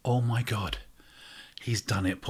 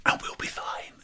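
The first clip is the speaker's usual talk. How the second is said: breathy whispered close; hushed aside; 4 dB quieter than usual. Acted. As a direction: throw it away in a whisper.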